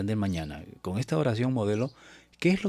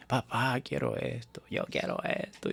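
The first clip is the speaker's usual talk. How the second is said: put-on child's begging whimper, airy; whining child; 4 semitones higher than usual. Child begging his father, whining. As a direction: beg like a small kid. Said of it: "y se pone a llorar".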